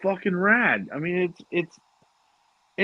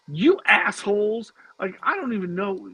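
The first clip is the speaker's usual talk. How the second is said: mock mad; 7 semitones higher than usual. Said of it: "she just got mad at us".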